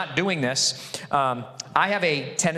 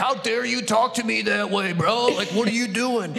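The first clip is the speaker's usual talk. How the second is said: higher, mock picking a fight; mock aggressive bro; 7 semitones higher than usual. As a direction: playfully square up like you're ready to brawl. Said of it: "you'd be like".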